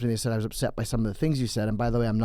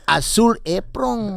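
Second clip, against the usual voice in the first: put-on Spanish accent; 7 semitones higher, much slower than usual. Mangling a sponsor name.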